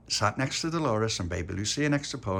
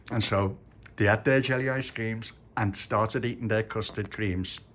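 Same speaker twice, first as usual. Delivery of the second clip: usual speaking voice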